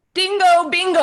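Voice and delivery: high, loud bright burst of recognition; excited realisation. Retold or imitated. Recalling her instant yes to the inner voice, excited high burst. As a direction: shout it out like it just clicked.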